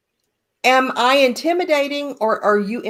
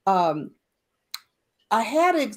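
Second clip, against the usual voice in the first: ordinary delivery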